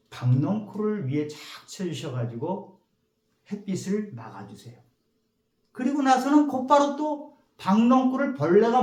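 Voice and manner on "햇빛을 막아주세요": usual speaking voice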